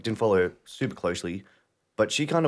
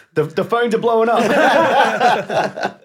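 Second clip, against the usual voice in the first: hammed-up excited announcer, much higher, louder and slow, laughing; mock hype; 13 semitones higher, 9 dB louder, much slower than usual. Cohost jokes to listeners about sponsor calls, hammed-up excitement. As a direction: ham up the news that the phones are ringing off the hook.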